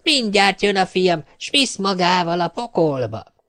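put-on high, slow old witch voice; a gleeful witch luring him to hell. Witch speaks to poor man. (magas hangú, gúnyos boszorkány)

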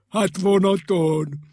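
loud, slow, delighted answer; joyful eureka voice; cheered up. A narrator's reading. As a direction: announce the happy idea. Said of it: "s rögtön vidámabb lett".

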